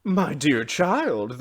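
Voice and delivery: loud, drawn-out motherly dismay; exclaiming. A narrator's reading. Mother hears the child's confession, exclaiming. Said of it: "the mother exclaimed".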